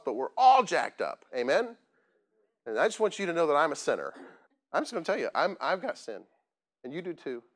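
normal talking voice

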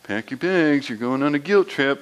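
a hint of a mom's teasing singsong; lightly voiced mom calling from the background. Mom teasing the guilt-tripping brother, lightly voiced. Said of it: "my mom would often be in the background going".

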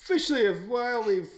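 rally the crew like a pirate host; hyped intro. Acted. Show intro greeting.

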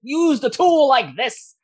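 high, loud quarrelling merchant; yelling. Acted. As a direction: yell in exasperation at a bungling workmate. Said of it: "You hear them yelling at each other".

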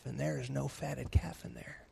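whispered reveal; dramatic whisper. Acted. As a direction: drop to a hushed whisper for the reveal.

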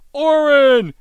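very loud, high yell of the name; shout. Retold or imitated. Captain yells to Arthur before throwing him the weapon, a loud high yell. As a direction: yell across the fight to catch his eye.

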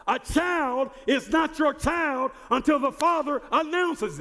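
rising, ringing sermon voice, pushing to shouts; full-force preaching. Acted. Pastor preaching the baptism of Jesus to his church, full force. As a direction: preach it hard, building to a holler.

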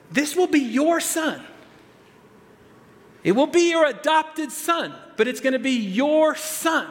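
angel's assurance. Retold or imitated. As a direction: assure him, no compromise. Angel speaking to Joseph.

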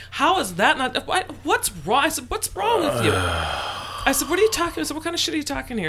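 retold fight with his birth mother, loud outrage; outraged shouting. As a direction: let the disbelief boil over. Retold heated argument with mother.

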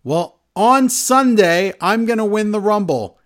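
loud, high, declaimed boast; mock-grand promo proclamation; retold wrestler boast. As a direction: imitate a wrestler's big announcement. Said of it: "Gunther says".

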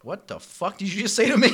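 imitating a furious server; mock angry retort. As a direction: snap back in disbelief, hammed up. Retold angry outburst.

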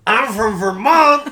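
loud, high, very slow mock Vermont accent; hammed-up Vermont accent. Shown as a demonstration. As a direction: holler it in a drawn-out Vermont accent. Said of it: "Little different".

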